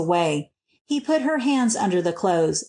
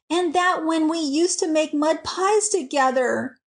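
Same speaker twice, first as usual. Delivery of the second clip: higher-pitched, mildly indignant reading; 7 semitones higher than usual. A narrator's reading. Alice ends her account of the cousin's insult, indignant. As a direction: voice a touch of wounded indignation. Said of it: "concluded Alice with indignation".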